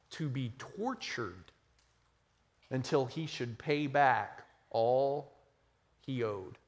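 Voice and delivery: measured, dramatic parable reading; scripture reading. Acted. Preacher reads the parable to the congregation, dramatic reading.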